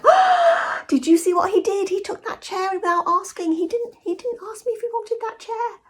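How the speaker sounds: high, scandalised gasping gossip; high-pitched shocked Brit tattling about a rude neighbour; outraged British gossip. Retold or imitated. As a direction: clutch your pearls over a stolen chair. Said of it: "the British would be like".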